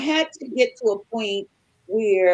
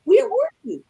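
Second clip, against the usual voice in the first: upset demand; 11 semitones higher, 3 dB louder than usual. Retold or imitated. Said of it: "I'm like".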